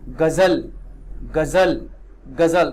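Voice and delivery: गजल said loudly with a buzzing z in the middle; z sound in ghazal. Shown as a demonstration. Teacher repeats गजल so students hear the z. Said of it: "कौन सा ज आ रहा है".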